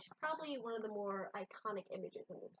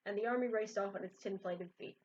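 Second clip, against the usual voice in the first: reading a picture book aloud; lively read-aloud storytelling; story narration; 4 semitones higher, 6 dB louder than usual. A narrator's reading.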